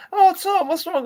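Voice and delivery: a taunting sing-song of fake sympathy; mock concern. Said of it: "[name] comes in for some sweet revenge".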